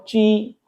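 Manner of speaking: "ची" with a voiceless ch, not j; ch sound. Shown as a demonstration. Teacher naming the sound, long "ची". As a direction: draw "ची" out level on the ee. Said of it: "चे का sound".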